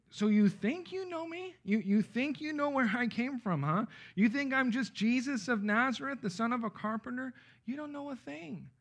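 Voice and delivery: high-pitched ironic challenge; holy irony. Acted. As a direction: question them as if mocking their certainty. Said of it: "interpreted as being holy irony".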